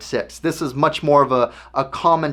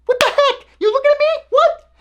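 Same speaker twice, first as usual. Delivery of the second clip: much higher, mock-tough squeaky challenge; a hammed-up boxer voice picking a fight, going ever higher; Mike Tyson impression. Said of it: "sorry about my horrible impersonation".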